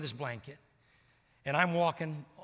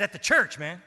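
loud, higher, cheeky reveal; Steve's punchline; 5 semitones higher, 13 dB louder than usual. Retold or imitated. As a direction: land the twist proudly.

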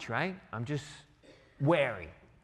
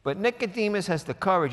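ordinary delivery

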